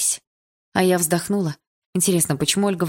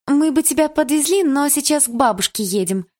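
high, eager apology; apologetic; 9 semitones higher, 4 dB louder than usual. A narrator's reading. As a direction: say sorry, bright and quick. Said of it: "извиняющимся тоном сказала Дилара".

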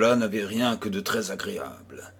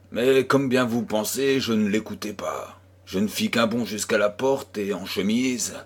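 ordinary delivery, nothing marked

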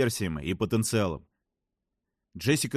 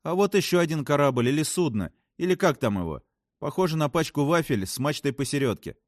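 higher, eager report of strange sights; fascinated astronaut; 6 semitones higher than usual. Acted. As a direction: describe it with wonder, pitched up. Voices a story character. (увлечённая астронавтка, высоким голосом)

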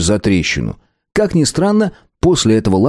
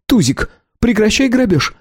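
higher, outraged; indignant; 13 semitones higher than usual. A narrator's reading. Clan leader telling Tuzik off, higher. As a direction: tell him off, indignant. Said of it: "опять возмутилась глава клана".

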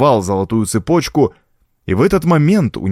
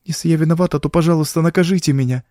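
a small contrite plea; pleading girl; 6 semitones higher, faster than usual. A narrator's reading. Frightened girl to her mistress, pleading.

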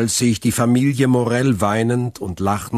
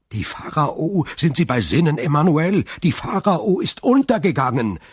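can't believe his ears; stunned disbelief; 7 semitones higher than usual. A narrator's reading. Shipowner hears his sunk ship is back, stunned. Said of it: "rief Morel fassungslos".

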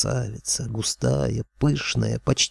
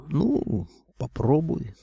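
hesitant, higher-pitched doubt; drawn-out, unsure go-ahead; doubtful; 4 semitones higher, slower than usual. A narrator's reading. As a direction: agree with obvious reservations. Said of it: "с сомнением кивнул я".

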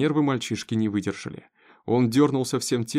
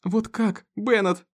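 high cry, louder on the name; distressed exclamation; 10 semitones higher, 3 dB louder, slower than usual. A narrator's reading. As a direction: lose composure and cry out. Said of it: "не выдержала и воскликнула". (не выдержав, воскликнуть)

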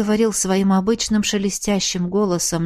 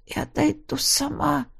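weak protest; 6 semitones higher, 6 dB quieter than usual. Acted. Weak heroine insists.

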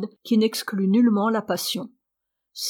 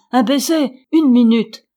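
raised, louder begging; urgent pleading; 3 semitones higher, 8 dB louder than usual. A narrator's reading. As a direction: beg for one last moment, voice pushed up. Old woman pleads with departing son. (supplication pressante, voix haussée)

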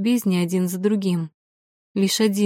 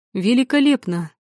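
delighted; 4 semitones higher than usual. Acted. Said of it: "обрадовался Мурс".

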